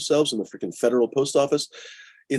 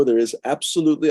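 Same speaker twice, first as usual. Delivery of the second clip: normal talking voice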